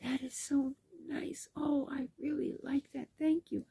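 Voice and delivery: high, soft, half-whispered gushing; retold thanks in a small high voice; imitating touched gratitude. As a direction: play a lady moved by a gift. Retold lady thanking for scarf.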